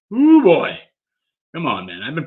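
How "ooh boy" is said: real high, loud nervous whoop; excited exclaim. Real, not acted. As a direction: belt out the nerves before the reveal. Nervous before revealing next grade.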